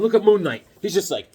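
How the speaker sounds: normal talking voice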